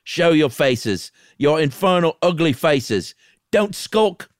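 loud, high taunting shout; theatrical yelling, pitched high; defiant cry. A narrator's reading. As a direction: dare the hidden intruders to come out. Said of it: "he cried with a low laugh".

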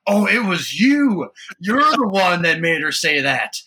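loud, high, drawn-out mock accusation; angry mother-in-law. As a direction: imitate an outraged grandma pinning the blame. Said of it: "she was angry telling us this story".

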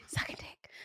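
laughing imitation of a whisper; mock whisper. Co-host teasing the whispered vacation wish, mock whisper. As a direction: playfully mimic the co-host's hushed words. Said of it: "Why you whisper it?".

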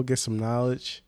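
normal talking voice

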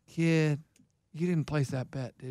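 low, soft, deadpan coach impression; Keith. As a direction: imitate an older friend's low, quiet phone voice. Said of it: "No, he said".

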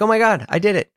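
lightly mimed amazement at managing it; mild retold relief. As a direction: play up a small private victory. Relief at finally falling asleep.

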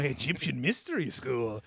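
mock Egyptian accent. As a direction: put on a jokey Egyptian accent. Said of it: "classic Egyptian accent".

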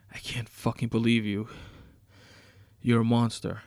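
loud, outraged condemnation; angry accusation. Acted. Captive condemns his captor.

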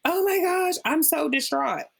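high, hammed-up wail of distress; mock-dramatic high voice; mocking fake distress. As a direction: parody a friend overdoing their grief. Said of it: "when they're just like".

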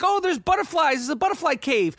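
high mock-breezy voice; mocking his lady's breezy tone; mocking lady's calm. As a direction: lightly mimic her as if it's nothing. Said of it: "she's talking about it like".

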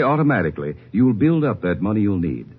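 normal talking voice

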